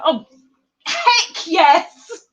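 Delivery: loud, high exuberant cheer; emphatic agreement. Real, not acted. Agreeing with Bev about never enough yarn, exuberant shout. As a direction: burst out in whole-hearted agreement.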